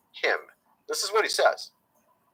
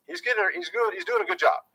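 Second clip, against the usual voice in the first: "he's doing a good job" pitched up high, mocking; sarcastic falsetto praise; 13 semitones higher, faster than usual. Shown as a demonstration. Said again in a new voice.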